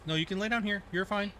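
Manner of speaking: high, soft reassurance to the cat; pet talk. Real, not acted. Soothing the cat.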